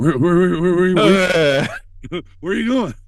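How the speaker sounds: high, loud, stretched mock panic; panicky repeated where. [name] to host sneaking out: high mock-panicked calling. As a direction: mimic a jumpy scared friend calling out.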